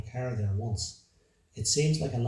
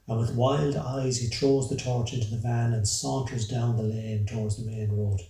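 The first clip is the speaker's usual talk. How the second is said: ordinary delivery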